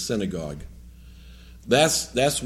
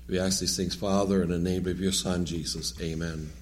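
soft, lowered prayer voice; 4 semitones lower, 5 dB quieter than usual. Acted. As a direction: pray quietly and reverently. Opening prayer.

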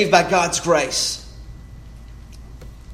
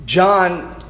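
usual speaking voice

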